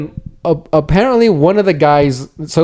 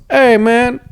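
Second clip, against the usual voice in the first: higher, easygoing put-on voice; 9 semitones higher than usual. Friend imitating a stranger asking for the bathroom, breezy higher voice. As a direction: toss off a breezy imitation greeting. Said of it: "That's what they sound like".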